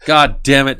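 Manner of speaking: loud, high comic exasperation; mock angry outburst. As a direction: holler in hammed-up frustration. Comic outburst at losing the channel.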